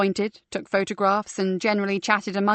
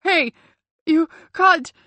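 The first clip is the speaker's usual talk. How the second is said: a sudden loud, higher cry of protest; a knocked-down guide crying out, loud and higher; winded protest; 8 semitones higher, 6 dB louder, slower than usual. A narrator's reading. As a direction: shout it out as you hit the floor.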